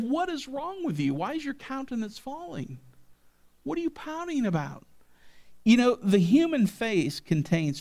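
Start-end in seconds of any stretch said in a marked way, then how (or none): 0.0-4.8 higher-voiced, pleading divine rebuke; God confronting Cain. God questions sulking Cain, higher and pleading. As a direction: ask it like a grieved father questioning his son. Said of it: "God said".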